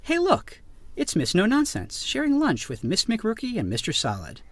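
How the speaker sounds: ordinary delivery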